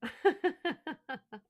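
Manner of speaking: high, rapid giggle; real release of giggles; laugh. Real, not acted. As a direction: let out a high burst of laughter. Laughs after taking a breath.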